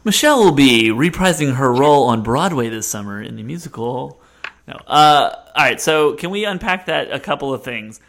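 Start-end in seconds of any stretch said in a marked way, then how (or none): 0.0-4.2 loud mock announcer. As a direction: announce it like a showbiz host. Mock announcement after the reading.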